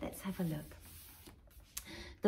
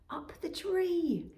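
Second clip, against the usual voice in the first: ordinary delivery, nothing marked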